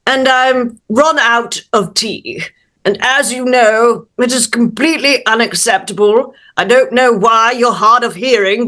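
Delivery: very proper, put-out British mouse; indignant little British gentleman, formally polite; British accent. Acted. Mouse introduces himself to the householder, indignant British accent. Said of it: "in his best little British accent".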